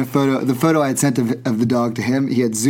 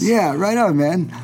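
eager agreement; 7 semitones higher, slower than usual. Retold or imitated. Acts out his keen reply to George.

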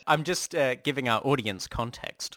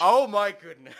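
surprised laugh; 7 semitones higher, 5 dB louder than usual. Real, not acted. Shocked at the post.

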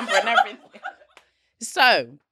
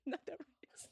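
barely voiced whisper; 2 semitones higher, 26 dB quieter than usual. Real, not acted. Insisting the app exists, whispered aside. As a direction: breathe it under the laughter. Hushed aside while laughing.

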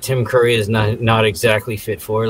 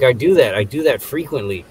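ordinary delivery

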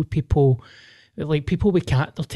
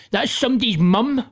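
higher, light mock of the ex-girlfriend; imitating ex; 8 semitones higher than usual. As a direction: quote the ex in a higher reproachful voice. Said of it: "my ex was like".

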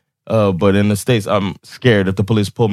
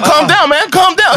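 much higher, laughing mock soothing; a hammed-up condescending calm down; mocking the stranger; 3 dB louder than usual. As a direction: parody his patronising calm down. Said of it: "he's like".

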